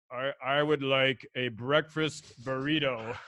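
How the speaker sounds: slow, put-on deliberate voice; mock manly order. Retelling ordering a breakfast burrito from the waiter. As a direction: order it slowly, trying to sound manly. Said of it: "And I said".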